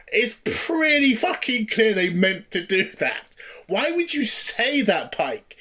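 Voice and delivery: indignant disbelief, leaning hard on the words; pissed off. Retold or imitated. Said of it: "where I was pissed off".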